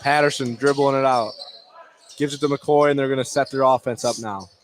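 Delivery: usual speaking voice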